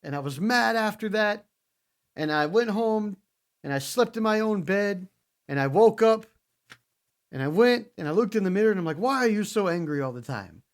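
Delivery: imitating Drew McIntyre's self-pep talk; mock Drew McIntyre. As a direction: play him boasting to the mirror. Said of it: "he's like".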